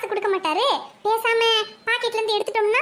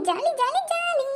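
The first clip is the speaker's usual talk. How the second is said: higher, bouncing cheer; gleeful; 7 semitones higher, slower than usual. Acted. Wife cheering to herself once her husband leaves. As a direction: squeal it with glee.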